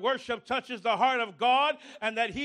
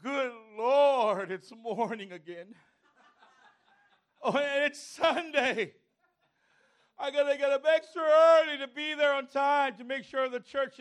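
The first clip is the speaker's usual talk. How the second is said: groggy reluctant complaint. Retold or imitated. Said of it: "Or do you wake up and say".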